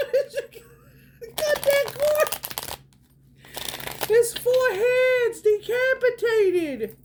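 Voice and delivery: high, drawn-out words through laughs; loud high laughing voice; helpless laughter. Retold or imitated. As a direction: squeal it while cracking up. Cracking up over own horror bit.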